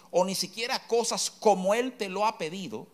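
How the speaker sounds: ordinary delivery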